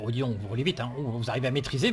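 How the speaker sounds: usual speaking voice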